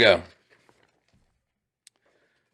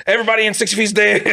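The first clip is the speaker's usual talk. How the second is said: high, quick playground kill announcement; hammed-up high-pitched victory call; pretend soldier; 12 semitones higher, faster than usual. Reenactor declaring his pretend kills, fast high voice.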